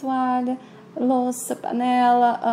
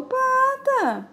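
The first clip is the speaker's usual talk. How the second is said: drawn-out high squeal; 14 semitones higher than usual. Acted. Tio Nardo espantado.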